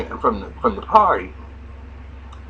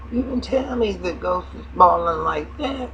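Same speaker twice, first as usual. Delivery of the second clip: higher put-on woman's voice; 10 semitones higher than usual. Retelling the show, he voices the woman talking to Slim in a high pitch. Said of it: "she was like".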